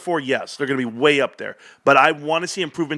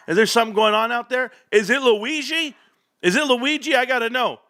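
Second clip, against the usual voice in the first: much higher, wondering aloud toward the dogs; curious calling out; 9 semitones higher than usual. Real, not acted. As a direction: perk up and call out to whoever is sneaking around. Hears dogs, wonders who's sneaking.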